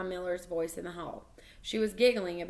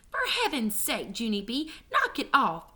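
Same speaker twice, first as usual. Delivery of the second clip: scolding mother, raised pitch; exasperated yell; 4 semitones higher than usual. A narrator's reading. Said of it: "she yelled".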